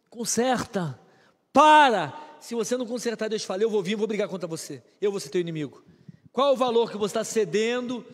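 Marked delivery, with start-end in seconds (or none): 0.1-2.1 sharp command. Acted. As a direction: bark it out to halt them. Preacher orders stop to congregation.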